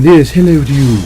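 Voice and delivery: gnome voice. Retold or imitated. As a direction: chirp it like a little game gnome. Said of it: "as those little gnomes going".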